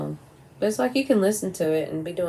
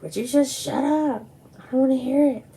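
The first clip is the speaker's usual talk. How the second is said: higher, lightly voiced exasperated mother; imitating the fed-up mom; 8 semitones higher, 5 dB louder than usual. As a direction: snap it like a tired mom ending a fight. Mom in retold quarrel.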